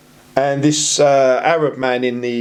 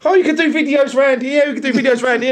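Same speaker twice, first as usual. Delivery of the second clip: laughing retold officer; 9 semitones higher than usual.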